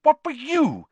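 very high, loud splutter; flustered high-pitched questioning; shocked. A narrator's reading. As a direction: demand an answer, scandalised. Percy shocked at finding Ron.

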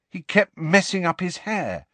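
high-pitched, pained complaint. A narrator's reading. Harry recalls his father's embarrassing habit, pained. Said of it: "said Harry in a pained voice".

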